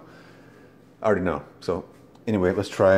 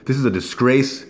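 higher and louder, outraged; indignant outburst; 6 semitones higher, 5 dB louder than usual. Real, not acted. Condemning Kool-Aid to viewers, loud and outraged. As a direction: repeat it as an outraged exclamation.

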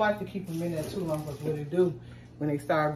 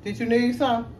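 real raised-voice question; calling out; 6 semitones higher than usual. Real, not acted. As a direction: call over to check what they need. Calls out to someone nearby.

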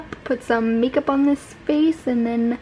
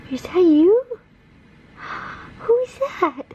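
high cooing; 6 semitones higher than usual. Real, not acted. Cooing to baby.

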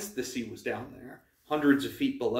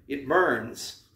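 faked misery. A narrator's reading. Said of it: "I lied, trying to sound miserable".

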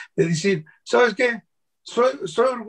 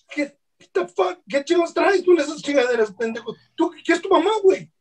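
retold heated comeback; 5 semitones higher than usual. Telling off a guy who says mojado, high laughing mock rant. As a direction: rub it in his face, half joking. Retold argument about slur.

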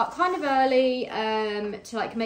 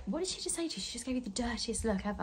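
hushed retold aside; 10 dB quieter, faster than usual. Friend reacts to the rude server, imitated in hushed disbelief.

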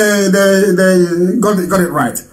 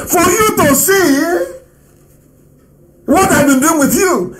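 excited cry; 8 semitones higher than usual. Real, not acted. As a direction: stretch it out high for effect. Rising outburst before the clip.

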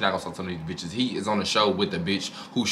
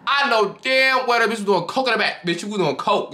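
pitched up, hammed-up disbelief; high incredulous rant; 12 semitones higher than usual. Real, not acted. Vlogger ranting to viewers about a cast member, high incredulous rant. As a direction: insist she did coke, voice squeaking high.

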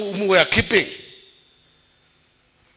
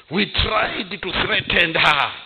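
ordinary delivery

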